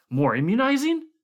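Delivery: high, loud, rising disbelief; loud outraged question. A narrator's reading. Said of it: "Condit exploded suddenly".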